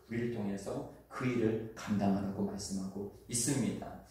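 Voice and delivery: ordinary talk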